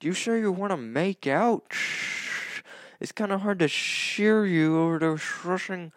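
shouting from the shower over the water; from another room. Shown as a demonstration. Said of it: "let me demonstrate what it would sound like".

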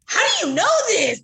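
high, pointed question as Sally; Sally's quote. Retold or imitated. Sally challenges Harry with a high, pointed question. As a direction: pitch Sally's doubt up high. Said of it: "She's like, she goes".

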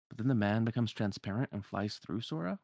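ordinary delivery

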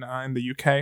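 ordinary talk

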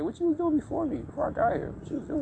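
high, soft mocking questions; mock challenging. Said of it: "I be telling bitches, like".

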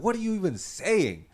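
high-pitched, incredulous grandma retort; concerned grandma. Retold or imitated. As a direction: imitate a grandma's startled question, voice jumping up. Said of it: "she looked a little concerned".

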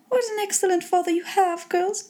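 high-pitched, gushing mother voice; high, understated matronly rapture; delighted mother. A narrator's reading. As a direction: praise him in the mother's high voice. Delighted mother praising father.

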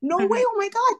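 flustered protest, peaking high at the end; freaking out. Retold or imitated. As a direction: turn down the offer in a frantic burst. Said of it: "freaking out at her".

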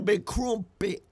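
slow, drawn-out mock British; British accent. Shown as a demonstration. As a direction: drag it out in a silly British accent. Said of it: "That's my British accent".

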